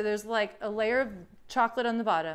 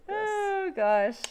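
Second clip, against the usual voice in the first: normal talking voice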